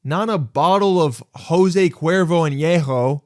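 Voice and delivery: high, emphatic exasperated rant; indignant complaint, hammering the key words. Acted. Exasperated rant about the petty theft.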